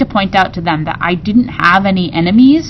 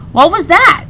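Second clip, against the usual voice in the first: surprised; 10 semitones higher, 4 dB louder than usual. A narrator's reading. Woman startled by splash.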